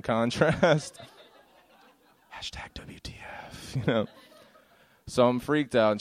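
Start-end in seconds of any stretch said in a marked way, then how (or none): 2.3-3.7 whispered hashtag aside; very soft, voiceless stage whisper; mock whisper. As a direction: whisper it like typing a caption. Mimes captioning the posted photo.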